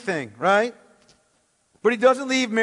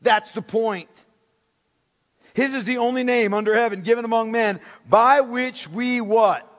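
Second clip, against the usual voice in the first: ordinary talk, nothing marked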